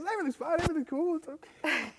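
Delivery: high-pitched, breezy caricature. Retold or imitated. Drummer reassuring everyone after the fiasco, comically carefree. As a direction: wave it all away in a squeaky mock voice. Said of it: "He's totally fine".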